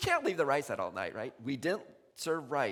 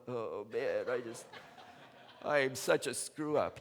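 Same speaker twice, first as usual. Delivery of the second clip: mock self-pity; 4 semitones lower, slower than usual. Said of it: "me going".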